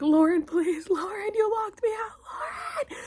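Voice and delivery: high, loud, wailing mock plea; retold desperate yelling. Calling to Lauren who locked her out, frantic retold wail. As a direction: act out a frantic cry for help.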